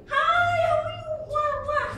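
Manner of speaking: very high, loud greeting to friends; over-the-top excited high hello; high pitch. Shown as a demonstration. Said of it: "That is high pitch".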